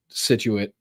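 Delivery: Scituate said as "SIT-choo-it"; local town pronunciation. Shown as a demonstration. Host showing odd town spellings, Scituate said locally as "sitchuit". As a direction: say Scituate with a silent c. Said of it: "spellings of Massachusetts towns".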